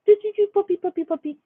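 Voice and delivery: sing-song high puppy talk; high baby talk to puppy. Retold or imitated. Mom riling up her puppy, high sing-song baby talk. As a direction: coo at the dog in a high voice.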